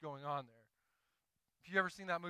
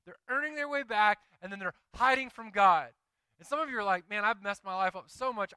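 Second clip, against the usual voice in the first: loud, higher preacher's cry; preaching; 4 semitones higher, 8 dB louder than usual. Acted. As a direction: press the point home to the congregation. Preaching at full force.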